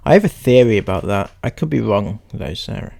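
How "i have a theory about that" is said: usual speaking voice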